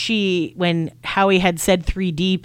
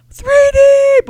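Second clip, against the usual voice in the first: high, loud shout of the band name; 10 dB louder than usual. Retold or imitated. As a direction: yell it out to the stage. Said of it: "we, like, shouted out".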